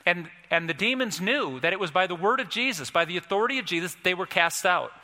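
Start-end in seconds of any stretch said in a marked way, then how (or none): none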